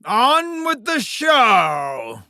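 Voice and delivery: long, drawn-out rallying cry; showman's shout. Acted. Host starting the show for the crowd, stretched shout. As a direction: bellow it slowly to the whole arena.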